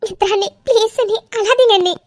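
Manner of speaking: high, pleading voice; pleading consolation. Acted. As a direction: beg her to stop crying. Pleading with crying sister-in-law.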